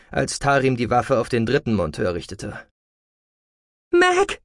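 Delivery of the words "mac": high, sharp warning; warning call. A narrator's reading. Aglaya warns her comrade against talking, sharp. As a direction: call her name to stop her. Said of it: "rief Aglaya warnend".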